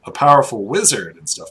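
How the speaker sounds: normal talking voice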